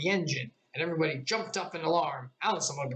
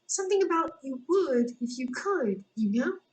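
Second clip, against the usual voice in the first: much higher, tiny insect voice; tiny gnat voice; 11 semitones higher, 3 dB louder than usual. A narrator's reading. Gnat whispering a joke into Alice's ear, tiny and high. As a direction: squeak it close in her ear. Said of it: "the little voice".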